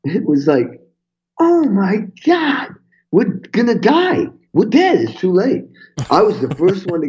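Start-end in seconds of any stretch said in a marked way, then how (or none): 1.4-5.6 high, slow, near-crying mock panic; half-joking doomsday wail; retold panic. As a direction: replay the dread, voice pitched up and stretched. Retold panic over AIDS article.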